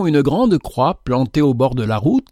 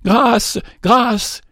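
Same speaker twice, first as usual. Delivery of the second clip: much higher, panicked pleading; terrified plea; 9 semitones higher than usual. A narrator's reading. Fainted man wakes and begs the stranger, terrified plea. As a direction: shriek for mercy, waking in terror. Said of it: "en voyant avec terreur".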